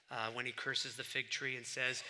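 ordinary talk

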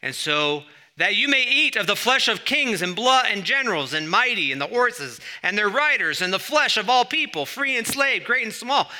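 high, booming proclamation; full-voiced, high-pitched summons from scripture; loud angelic cry. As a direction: cry out to all the birds as an angel. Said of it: "cried out in a loud voice".

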